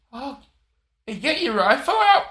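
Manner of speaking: high, loud, slow mock American drawl; a failed American accent, loud and slow. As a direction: attempt a cowboy American, badly. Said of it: "In America, it'd be".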